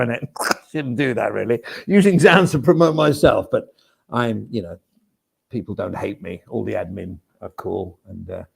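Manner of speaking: ordinary talk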